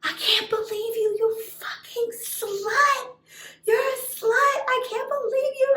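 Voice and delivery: very high-pitched shrieking tirade; hysterical outburst. Retold or imitated. Betrayed girl turns on best friend, hysterical shrieking. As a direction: shriek insults in a hammed-up frenzy. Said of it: "went from this crying, like, victim, precious angel to".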